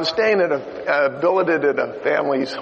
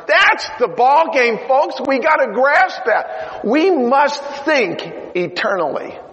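loud high exhortation from the pulpit; rally the congregation, push it out; preaching at full force; 8 semitones higher, 3 dB louder than usual. Real, not acted. Preacher urging the congregation to think eternally, full force.

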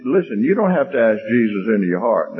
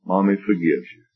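gentle, understated motherly reassurance; 3 semitones lower than usual. Acted. First take, mother's quiet comforting.

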